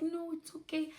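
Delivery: high imitated reassurance; reassuring staff. Nurses answering labouring mother, high reassuring imitation.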